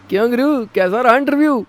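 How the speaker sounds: high, chummy call; a nosy conductor greeting a regular; cheery bus conductor. Acted. Conductor calls out to Ram on the bus, high and friendly.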